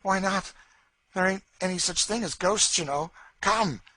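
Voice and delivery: lightly voiced taunting woman; mocking Cassie. A narrator's reading. Cassie mocks Legree's fear of ghosts, teasing.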